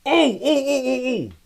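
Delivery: excited ooh. Real, not acted. Excited squeal at chat.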